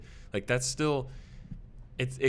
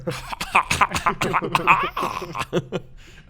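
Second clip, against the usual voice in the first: fake elite laugh; 10 semitones higher, 11 dB louder than usual. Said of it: "pretend and laugh like an elite".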